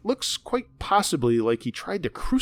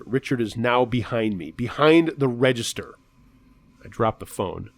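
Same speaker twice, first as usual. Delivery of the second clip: usual speaking voice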